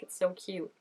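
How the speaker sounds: normal talking voice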